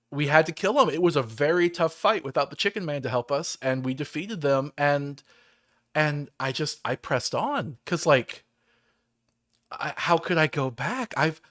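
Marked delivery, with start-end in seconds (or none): none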